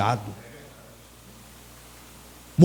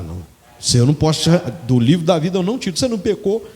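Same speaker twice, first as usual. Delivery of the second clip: lower, gentle voice of God to a friend; God's friendly reply; 6 semitones lower, faster than usual. Acted. God reassuring Moses that he will not kill him, lower and gentle. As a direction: soothe Moses like an old friend.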